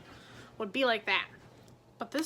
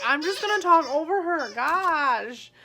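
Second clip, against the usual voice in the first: real laughter, louder, talking over the noise; laughing; 4 semitones higher, 9 dB louder than usual. Real, not acted. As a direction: laugh through it while raising the voice over the squeaking. Dog squeaking toy, she laughs.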